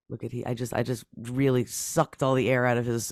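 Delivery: ordinary talk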